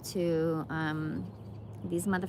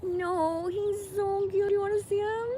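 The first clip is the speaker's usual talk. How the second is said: cooing; 12 semitones higher than usual. Real, not acted. Showing the cute animal to viewers, high coo.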